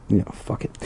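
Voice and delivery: whispered under breath; muttered. Real, not acted. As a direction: mutter it away. Reader gives up muttering.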